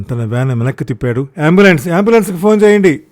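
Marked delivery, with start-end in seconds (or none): 1.5-3.0 high, loud, fast urgent shout; frantic bystander shouting for help. A narrator's reading. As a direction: yell for an ambulance in a panic. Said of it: "ఎవరో అరుస్తున్నారు".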